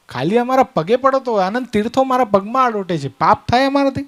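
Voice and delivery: offended self-importance. Acted. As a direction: puff yourself up as an affronted holy man. Acts offended holy man rebuking king.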